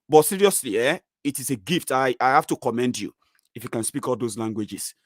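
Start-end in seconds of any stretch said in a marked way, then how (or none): none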